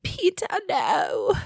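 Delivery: a hammed-up mystery accent like Moira Rose; unplaceable Moira Rose accent. Podcaster playing the movie's bride, hammed-up half-Australian accent. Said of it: "I don't know what accent I'm doing now".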